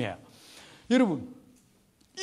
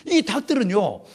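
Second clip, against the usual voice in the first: normal talking voice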